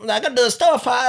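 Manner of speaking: hammed-up high-pitched Vietnamese accent; exaggerated Vietnamese-accent impression, very high. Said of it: "Vietnamese booth, they're like".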